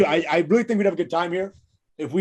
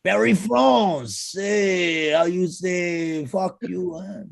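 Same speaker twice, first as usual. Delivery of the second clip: put-on slow, drawn-out French accent; mock French accent; 3 semitones higher, much slower than usual. Signing off from Paris in a mock French accent. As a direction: play a hammy Frenchman.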